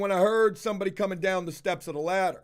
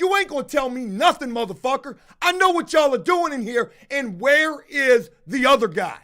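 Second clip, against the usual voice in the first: loud, higher furious yell; angry; 4 semitones higher, 5 dB louder than usual. Retold or imitated. Owner yelling back at the worker, loud and furious. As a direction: shout him down, furious. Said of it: "I said".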